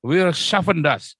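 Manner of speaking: German slogan said slowly and proudly; confident German. Retold or imitated. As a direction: proclaim it like a determined German leader. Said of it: "Tapi orang Jerman bilang apa?".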